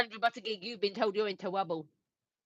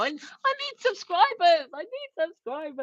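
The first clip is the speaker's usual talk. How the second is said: mock plea; 6 semitones higher, 3 dB louder than usual. Mock begging after plugging herself.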